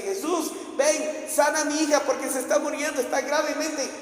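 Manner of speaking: high, insistent begging; urgent plea. Retold or imitated. Father begs Jesus for his dying daughter, urgent plea. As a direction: plead urgently, pitch held high.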